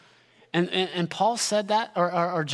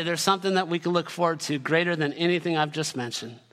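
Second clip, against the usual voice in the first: normal talking voice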